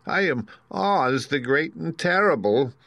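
high, slow, nervous announcement; trembling voice. Acted. Exposed wizard introducing himself to attackers, trembling. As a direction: announce yourself grandly but timidly. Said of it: "in a trembling voice".

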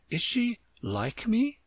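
high, slow, hopeful question; eager. Acted. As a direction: ask it eagerly. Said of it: "Alice asked eagerly".

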